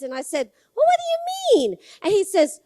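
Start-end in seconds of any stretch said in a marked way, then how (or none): 0.8-1.8 rapid, high-pitched baffled question; imitated shocked double take; retold alarm. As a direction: blurt it fast and high, acting out a startled mom. Retold mother's alarmed question to son.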